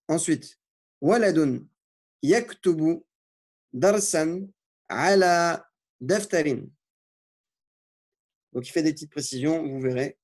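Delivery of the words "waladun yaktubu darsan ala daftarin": slow, deliberate dictation of Arabic words; careful teacher dictation with pauses between words. Shown as a demonstration. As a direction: dictate each word slowly so students can write it. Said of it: "l'autodictée que je vous lis".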